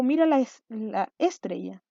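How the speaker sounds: slow "estrella" misstressed as ÉS-trella; stress on first syllable. Shown as a demonstration. Teacher trying it in a sentence, "estrella" stressed on ES. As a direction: put the beat on ES in "estrella". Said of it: "vamos a ver dónde golpea más fuerte el sonido".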